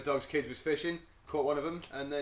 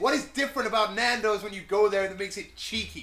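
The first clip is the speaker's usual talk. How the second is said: higher, louder New Yorker demanding answers; New York accent; 6 semitones higher, 6 dB louder than usual. Retold or imitated. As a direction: push it like an exasperated New Yorker. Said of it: "He's, he's got a bit New Yorkie".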